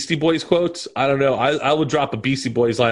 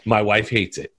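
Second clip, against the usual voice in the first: ordinary talk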